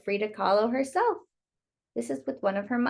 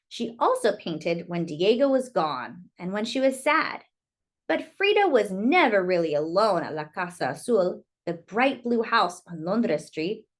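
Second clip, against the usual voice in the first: story narration. Acted. Reading Frida's story to children, lilting storyteller voice. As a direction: tell it like a bedtime picture book. Reads picture book aloud.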